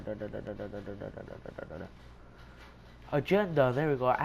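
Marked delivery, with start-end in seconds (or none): 0.0-1.8 low, soft da-da-da fading away; murmured placeholder syllables, sinking low; absent-minded filler. Real, not acted. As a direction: trail off quietly while hunting for the word. Filler while searching for a word.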